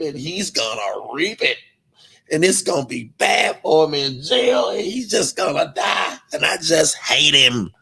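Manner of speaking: slow, put-on preachy mimicry; mocking whiny voice. Imitating his critics gossiping about him in a slow, put-on voice. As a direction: drag it out like a smug gossip. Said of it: "You over there talking about me".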